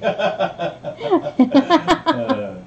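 high burst of laughter; loud laugh. Real, not acted. Bidder joke, big laugh.

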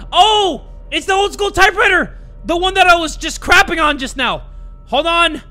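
high, loud burst of discovery; excited realization. Real, not acted. Player solving the riddle of the typewriter, shouting excitedly. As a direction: shout it as the answer hits you.

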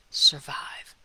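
breathy whisper of exhaustion; worn-out whisper. Acted. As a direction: let the word barely escape. Overwhelmed, just trying to survive.

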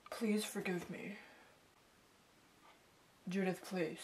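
begging in a whisper; whispered plea. Acted. Begging again.

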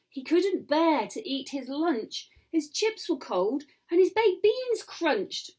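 ordinary delivery, nothing marked

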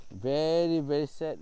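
VERY said high and loud; stressed very. Real, not acted. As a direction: lean hard on VERY. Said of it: "it's very sad now".

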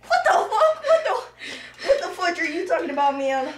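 high laughing "what the fuck"; laughing disbelief. Real, not acted. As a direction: sputter it out through laughter.

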